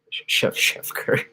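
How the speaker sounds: slow whispered giggle; laughing. Real, not acted. Streamer repeating the chat's joke, stifling laughter. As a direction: breathe it out while trying not to laugh. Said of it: "Sorry, I shouldn't laugh".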